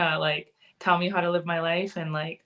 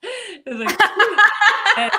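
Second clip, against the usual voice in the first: laughing; 13 semitones higher, 8 dB louder than usual. Real, not acted. Laughing while telling story.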